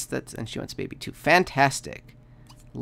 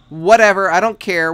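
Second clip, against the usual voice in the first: dismissive; 5 semitones higher, 11 dB louder than usual. Real, not acted. Player snaps at ignoring Sim.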